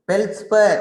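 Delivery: feldspar said high and loud, stretched out for the class; teacher's model word. Shown as a demonstration. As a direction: call out feldspar for the students to repeat.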